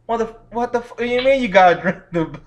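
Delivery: ordinary delivery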